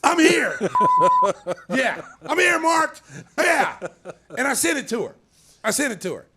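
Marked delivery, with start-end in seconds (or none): 0.0-3.8 high, loud laughing announcement; laughing shout. Real, not acted. To Mark, laughing loud. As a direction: burst in laughing, calling out.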